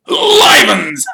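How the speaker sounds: loud, high mock-astonished shout with laugh; Sir Patrick. A narrator's reading. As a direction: cry out in fake shock, then chuckle. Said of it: "said Sir Patrick".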